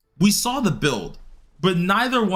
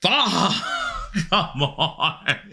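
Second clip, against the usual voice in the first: disbelieving laugh; 4 semitones higher, much slower than usual. Real, not acted. Bad drop rate, laughs.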